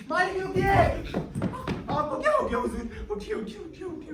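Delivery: rousing a master in a panic; urgent waking call. Acted. Servant waking master from nightmare.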